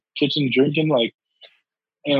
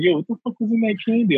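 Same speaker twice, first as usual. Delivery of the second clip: mock surprise; 9 semitones higher, faster than usual. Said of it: "She's like".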